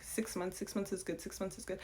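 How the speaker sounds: tossing off the family's line quietly; mock family advice. Imitating relatives who say six months is enough, softly.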